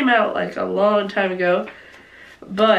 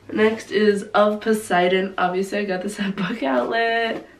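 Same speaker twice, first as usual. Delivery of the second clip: ordinary talk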